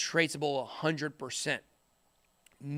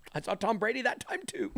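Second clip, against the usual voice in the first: hushed, breathy aside; whisper; 3 semitones higher, 7 dB quieter than usual. Real, not acted. Vlogger whispering a Super Bowl memory to viewers. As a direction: lean in and whisper it like a secret.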